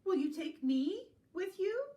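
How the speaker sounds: high, loud eager request; high-pitched pleading dog voice; storybook dog. Acted. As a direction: ask excitedly as the dog. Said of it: "said the dog".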